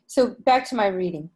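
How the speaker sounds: ordinary delivery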